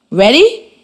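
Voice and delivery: ordinary talk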